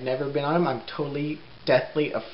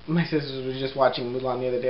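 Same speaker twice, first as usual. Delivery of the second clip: ordinary talk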